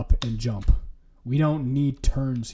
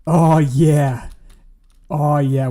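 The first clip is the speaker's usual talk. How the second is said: excited; 3 semitones higher, 8 dB louder, much slower than usual. Real, not acted. Car jumps, excited cheer.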